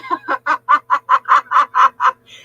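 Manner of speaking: loud burst of real laughter; laughing hard at her own story. Real, not acted. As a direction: let a big laugh go. Laughing at friend's memory.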